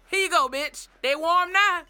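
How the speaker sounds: triumphant sassy "here you go"; mock sassy waitress. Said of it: "I'm going to say what you're thinking".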